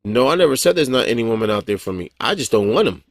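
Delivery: ordinary talk, nothing marked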